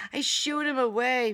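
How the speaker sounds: ordinary delivery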